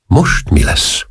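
slow, breath-only question; hushed, shocked dismay; aghast. A narrator's reading. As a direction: ask it faintly, drained with dread. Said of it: "kérdezte az őrnagy elsápadva".

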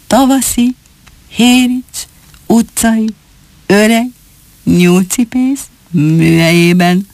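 each word set apart and slow; weighty words. Acted. Fox cub reveals the secret to his family, word by word. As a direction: drop every word like a weight. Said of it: "mintha mindegyik egy-egy kilót nyomott volna".

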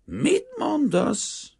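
very high, drawn-out, strained question; hoarse, faltering. A narrator's reading. Banker who cannot believe the refusal, hoarse and faltering. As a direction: force it out slowly, hoarse with shock. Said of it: "kérdezte rekedten és akadozó nyelvvel".

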